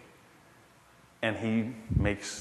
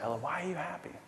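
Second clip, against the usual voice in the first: tender father; 6 semitones higher, 8 dB quieter than usual. Retold or imitated. Father asks daughter tenderly.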